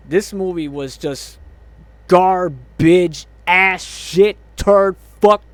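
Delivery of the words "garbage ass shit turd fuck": annoyed outburst. Real, not acted. Angry reviewer listing insults for the movie, annoyed. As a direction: punch out each word separately, louder. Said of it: "I am seriously annoyed".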